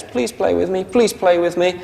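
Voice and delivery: high, pleading little puppy voice. Retold or imitated. Trainer voicing Jack begging to play, high and pleading. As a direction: beg like a lonely pup. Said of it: "poor old Jack says".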